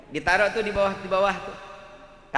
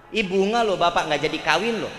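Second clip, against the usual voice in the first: normal talking voice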